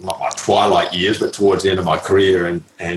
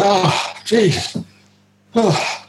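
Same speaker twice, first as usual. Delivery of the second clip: much higher mock dismay; exasperated groan; 13 semitones higher, much slower than usual. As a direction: act out sinking at the thought of a chatterbox. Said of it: "And it's like".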